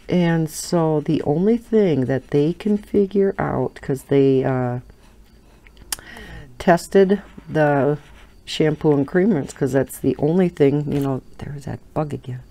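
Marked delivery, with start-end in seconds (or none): none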